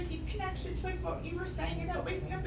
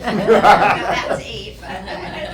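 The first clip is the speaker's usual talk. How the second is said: laughing; 6 semitones lower, 16 dB louder, much slower than usual. Real, not acted. Joke about mother's five marriages.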